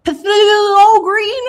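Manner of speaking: squeaky, drawn-out disbelief at a strange word; high and slow, can't believe this name; baffled high question. Real, not acted. Reading a weird paint label aloud, baffled high squeak.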